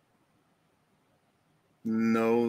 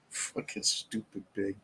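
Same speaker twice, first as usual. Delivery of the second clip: muttered insult; 8 semitones lower, 13 dB quieter than usual. Acted. Muttering at pig toy.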